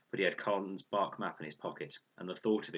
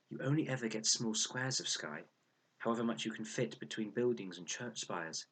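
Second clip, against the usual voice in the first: ordinary delivery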